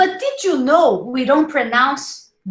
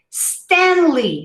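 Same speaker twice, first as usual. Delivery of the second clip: normal talking voice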